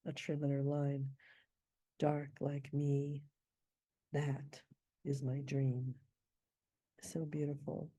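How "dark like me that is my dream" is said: "Dark like me", pause, "that", pause, "is my dream", slowly; ghost rests. Shown as a demonstration. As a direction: let silent beats hang before "is my dream". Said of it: "builds up the suspense".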